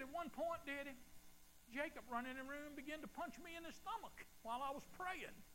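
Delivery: high-pitched retelling daughter; daughter's voice. Retold or imitated. Preacher voices his daughter telling about Jacob, high-pitched. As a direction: lift the voice for the daughter's story.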